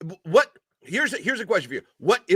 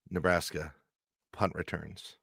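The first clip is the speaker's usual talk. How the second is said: low, hushed voice, much softer; hushed reveal; 13 semitones lower, 11 dB quieter than usual. Pretend. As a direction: lean in and murmur the secret stat. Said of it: "I am gonna whisper it".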